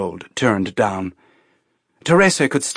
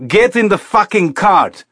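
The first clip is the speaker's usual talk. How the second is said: much higher, loud enraged command; furious order; 9 semitones higher, 6 dB louder than usual. A narrator's reading. Colonel losing temper with Teresa, furious order. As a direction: let the rage break through.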